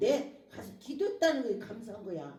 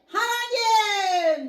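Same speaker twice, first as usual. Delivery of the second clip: high, loud, long-drawn plea; crying out to God; 10 semitones higher, 10 dB louder, much slower than usual. Shown as a demonstration. Believer shown how to weep to God, crying out. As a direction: wail the name in desperate prayer. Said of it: "하나님 부르고 울으라고".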